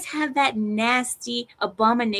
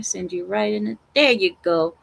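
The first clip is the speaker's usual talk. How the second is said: usual speaking voice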